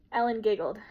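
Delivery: plain reading voice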